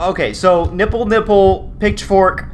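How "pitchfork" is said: ordinary delivery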